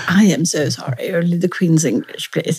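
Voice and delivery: mock professor in Queen's English; prim professor. Professor correcting a Cockney student, mock Queen's English. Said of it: "And then the professor would say".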